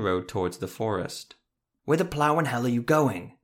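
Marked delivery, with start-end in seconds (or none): 1.8-3.4 loud, raised angry call; shouting. A narrator's reading. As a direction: yell it after a rider. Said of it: "Jeremiah shouted".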